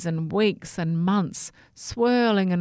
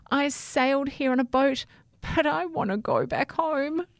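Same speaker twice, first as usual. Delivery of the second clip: high, small and pleading; a lost little one wanting home; small toothbrush voice; 8 semitones higher than usual. A narrator's reading. Toothbrush telling the rat he wants to go home.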